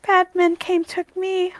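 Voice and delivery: high, soft, broken phrases; small animal recalling something painful; halting, high-pitched sorrow; sad monkey. Acted. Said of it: "looks very sad".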